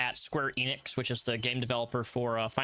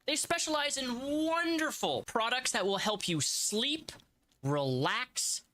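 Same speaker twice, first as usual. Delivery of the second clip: hammed-up sponsor pitch; upbeat salesman voice; ad read; 7 semitones higher than usual.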